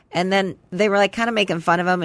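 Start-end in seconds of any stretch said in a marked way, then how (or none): none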